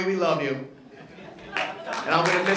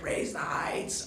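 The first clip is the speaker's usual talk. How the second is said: slow, strained whisper; imitated voiceless whisper; 2 semitones lower, 8 dB quieter, much slower than usual. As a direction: mimic a man with no voice left. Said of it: "Bob can't talk at all".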